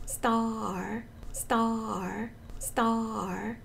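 measured drill of STAR, vowel first; long vowel, late r. Shown as a demonstration. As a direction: repeat STAR with an open "ah" and late r. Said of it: "So try star 하세요".

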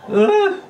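short, very high yelp; pained yelp. Real, not acted. Gamer losing the match, high yelp. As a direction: cry out high as defeat lands.

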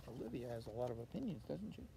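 usual speaking voice

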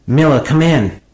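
exasperated crew member calling the captain; aggravated. A narrator's reading. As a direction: snap his name down the intercom.

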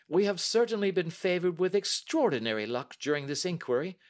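a brisk, confident Holmes; the detective testing the banker, lightly voiced; Holmes, cool and measured. A narrator's reading. Holmes voiced while reading.

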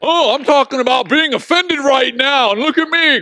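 high, loud mocking of dad; teasing mockery. Daughter teases dad about being offended, high loud mock. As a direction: rub it in playfully. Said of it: "she starts making fun of me".

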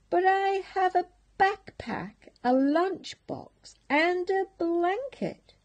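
a hammed-up high little pig protesting; pleading piglet. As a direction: plead your case earnestly. Rufus protests to captain.

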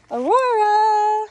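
high, loud, drawn-out call of "Aurora"; long high shout of a name. Real, not acted. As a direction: call her across the field. Calling goat across pasture.

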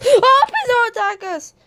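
gasp, then high mock-terrified plea; panicked pleading. Foxy begging the aliens for mercy, pretend terror. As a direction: beg in a high, play-frightened voice.

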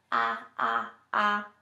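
loud open ahh for the exam; sustained open-mouthed ahh; saying ahh. Shown as a demonstration. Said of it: "while you say".

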